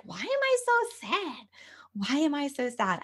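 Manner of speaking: imitating a high, whispery cry; retold tearful. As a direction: wail it lightly, as if retelling tears. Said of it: "I started to cry".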